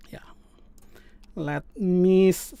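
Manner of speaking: normal talking voice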